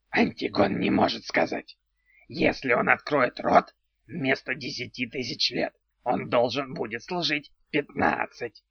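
odd creaky little house spirit; goblin voice. Acted.

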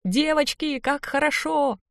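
high, joyful cry without extra volume; elated cry. A narrator's reading. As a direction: cry out to your girlfriends in bliss. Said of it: "Обняла подружек за талии и закричала".

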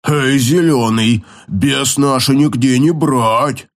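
slow, growled broken speech; growling orc. Acted. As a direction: growl it like a brute. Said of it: "прорычал Мургл".

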